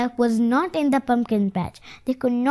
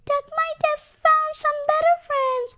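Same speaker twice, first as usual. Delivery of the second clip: sad little voice; faster than usual. A narrator's reading. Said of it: "whispered in a sad little voice".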